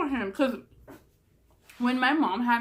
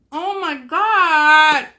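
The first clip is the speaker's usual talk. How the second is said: loud, drawn-out exasperated cry; much louder, slow "oh my God" at the cat; real startled yell; 5 semitones higher, 12 dB louder, much slower than usual. Real, not acted. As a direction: yell at the cat that pulled the light out. Cat pulls out her light.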